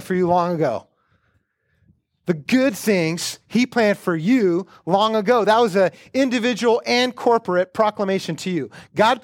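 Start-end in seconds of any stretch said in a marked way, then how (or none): 2.3-5.4 slow, each word set down; slow emphatic repeat. Shown as a demonstration. Pastor repeating the verse to the church, slow and weighty. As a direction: lean into good and slow it. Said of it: "so that you might do".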